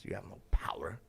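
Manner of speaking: whispered, low. Pretend. Telling a money-proud man he is powerless, whispered. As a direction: hush it like a secret verdict.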